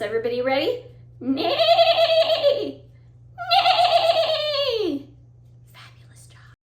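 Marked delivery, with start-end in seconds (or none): 1.2-5.1 high, long trembling whinny; whinny high and let it fall at the end; horse neigh. Shown as a demonstration. Teacher playing the horse sound for children, high whinny. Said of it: "Let's do the horse". 5.7-6.5 barely audible whispered compliment; hushed praise. Real, not acted. Teacher praising children after neighing, whispering.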